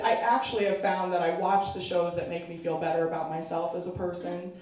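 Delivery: ordinary talk, nothing marked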